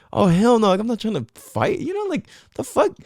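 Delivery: the inner ego recoiling from the question; mock outraged ego. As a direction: squeak it out, affronted. Inner ego reaction retold.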